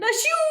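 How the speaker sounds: a sharp, high acted sneeze. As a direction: burst out with a big fake sneeze. Character sneezes in story.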